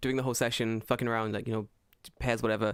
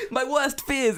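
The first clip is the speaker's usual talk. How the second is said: high, loud panicked wail with laughter; hammed-up horror, much higher and louder; mock panic; 13 semitones higher, 6 dB louder, slower than usual. Learner whose worst fears came true, mock-panicked wail. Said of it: "panicking with languages".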